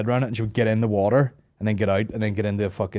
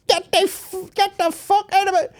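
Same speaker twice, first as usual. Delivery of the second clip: very high mock-mum screech; someone's angry ma; 4 dB louder than usual. Someone's ma wielding a tea towel, high mock scolding. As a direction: shoo them off in a piercing squawk. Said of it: "Someone's ma with a, with a tea towel".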